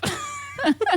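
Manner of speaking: high giggle as the intro lands; squeaky high laugh. Real, not acted.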